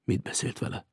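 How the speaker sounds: hushed, confidential question. A narrator's reading. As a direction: ask it very softly. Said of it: "Az öreg halkabban folytatta".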